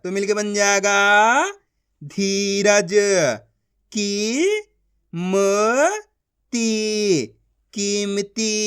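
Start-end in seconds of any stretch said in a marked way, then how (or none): 3.9-7.3 slow, each syllable of की-म-ती pulled apart; teacher sounding out a word. Shown as a demonstration. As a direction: sound out कीमती as की, म, ती with gaps. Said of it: "एक एक अक्षर का उच्चारण करके".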